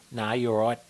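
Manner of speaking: put-on mumbled drunk reply. Imitating drunk Aussie reply.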